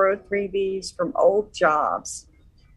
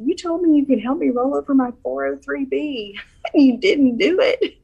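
laughing while quoting; 6 semitones higher than usual. Retold or imitated. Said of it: "But then he said".

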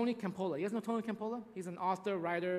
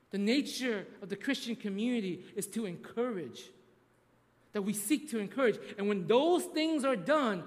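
rising sermon climax; 3 semitones higher, 3 dB louder than usual. Acted. Preaching climax.

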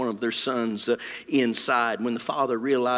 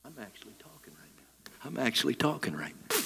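barely audible quick whisper off the mic; 2 semitones lower, 11 dB quieter, faster than usual. Shown as a demonstration. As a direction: whisper as if nobody can hear you. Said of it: "If I whisper up here without this mic".

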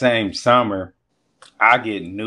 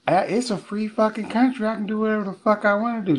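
high, fast indignant friend; high-pitched mock protest; mimicked defiance; 12 semitones higher, faster than usual. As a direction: imitate a defiant woman arguing back. Best friend defiant in retold quarrel.

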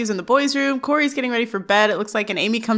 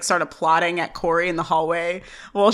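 ordinary talk, nothing marked

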